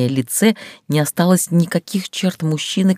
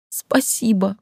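higher tearful thanks; sobbed; 6 semitones higher, slower than usual. Acted. Heroine sobs thanks to Dar, high tearful. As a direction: sob out your thanks. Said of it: "всхлипнула я".

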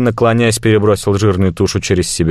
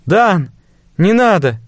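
calling out; 11 semitones higher, slower than usual. Acted. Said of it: "крикнули из-за спины".

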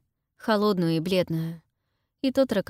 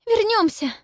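coughing up water; 6 dB louder than usual. A narrator's reading. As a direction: gasp it out between coughs. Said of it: "кашляя говорит Пиппа".

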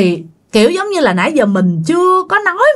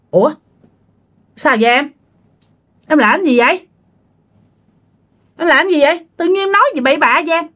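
confused husband; 6 semitones higher, faster than usual. Acted. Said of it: "cậu ba mới hỏi rằng".

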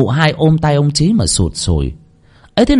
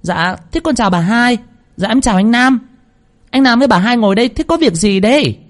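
high, pointedly loud servant greeting; greeting loudly; 11 semitones higher than usual. A narrator's reading. Maid greets them loudly to alert the house, pointed call. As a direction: call it out so those inside hear. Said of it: "con hầu chào rõ to".

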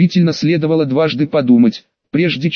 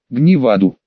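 usual speaking voice

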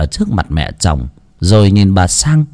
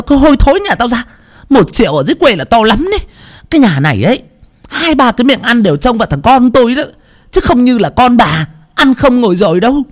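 contemptuous tirade; 12 semitones higher, faster than usual. Acted. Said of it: "ném vào người của bà Sang".

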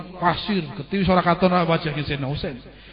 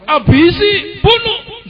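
perintah berteriak; 13 semitones higher, 7 dB louder, much slower than usual. Acted. Yazid memerintah pasukan membunuh.

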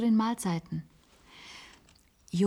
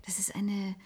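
whisper; 7 dB quieter than usual. Acted. Maggie describes strange unrest.

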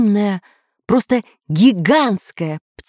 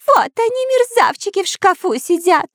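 very high squawking alarm; screeching parrot; 12 semitones higher than usual. A narrator's reading. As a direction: yell like a parrot being strangled. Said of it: "заорал, как резаный попугай". (пронзительный крик попугая)

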